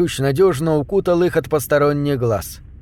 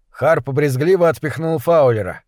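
ordinary delivery, nothing marked

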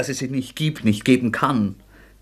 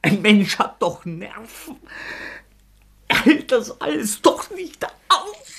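much higher and slow, voice straining upward; voice cracking in despair; 10 semitones higher, slower than usual. Acted. Rodja breaking down in front of Sonja, voice cracking high and slow. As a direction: nerves finally giving way.

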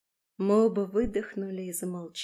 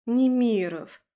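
higher, louder, stretched-out scolding; drawn-out reproach; 4 semitones higher, 8 dB louder, slower than usual. A narrator's reading. Woman chiding her lover by surname, reproachful drawl. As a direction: draw the surname out in disapproval. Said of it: "протянула я осуждающе".

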